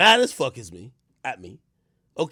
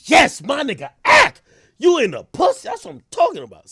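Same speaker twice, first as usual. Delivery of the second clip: much higher, louder, whooping cheer; hyped fans cheering; 12 semitones higher, 11 dB louder than usual. Retold or imitated. Fans cheering him for cheating, hyped yell.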